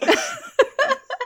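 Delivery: sudden high-pitched laugh; high giggle. Real, not acted. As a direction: burst into a squeaky giggle. Laughing after hover bike joke.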